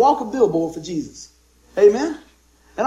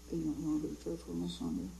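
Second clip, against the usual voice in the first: much softer mumble without moving the lips; mumbled invite; 7 semitones lower, 19 dB quieter, faster than usual. Retold or imitated. Churchgoer timidly inviting a friend to church, mumbled. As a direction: mumble it shyly under your breath. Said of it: "You don't even use your lips".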